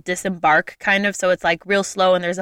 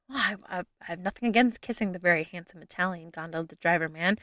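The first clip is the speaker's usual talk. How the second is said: coy aside; 2 semitones lower, 11 dB quieter than usual. Retold or imitated. Coy reply to the chanting crowd.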